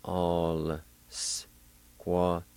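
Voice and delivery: ALL SQUADISKA broken into slow, separate syllables. Shown as a demonstration. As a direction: sound out "All squadiska" very slowly, pausing between syllables. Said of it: "pronounce the L sound ... like this".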